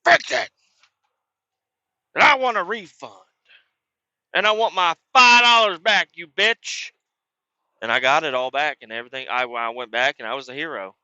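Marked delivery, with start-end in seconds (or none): 0.0-6.9 loud, high furious shout; angry demand. Retold or imitated. Customer demanding a refund from the cook, loud furious shout. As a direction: demand your refund at full volume. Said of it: "when you get angry, you start spitting".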